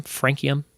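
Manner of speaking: "frankium" as FRANK-ee-um; hard-k pronunciation. Shown as a demonstration. Quiz host testing ways to say an element, hard-k version. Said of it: "I don't know how to say it".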